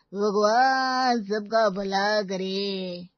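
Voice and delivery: slow, loud drawn-out blessing; beggar's blessing. Acted. Wife mimics a beggar blessing the mother-in-law, slow. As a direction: intone it like a grateful beggar.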